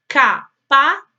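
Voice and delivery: "Capazes" in three separate beats, "Ca, pa, zes"; split into syllables. Shown as a demonstration. Teacher asking pupils to count, "Capazes" syllable by syllable. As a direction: pause between each syllable of "Capazes". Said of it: "separar as palavras em sílabas".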